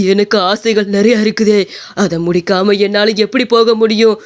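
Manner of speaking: put-on spirit voice, refusing to budge; stubborn possessing ghost. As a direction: argue back as a defiant ghost. (அடம்பிடிக்கும் பேய்க் குரல்)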